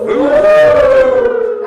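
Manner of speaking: long, high, loud ghostly wail; playful haunted-house "oooh"; spooky ghost "ooh". Pretend. Ghost noise after announcing Scary Bingo.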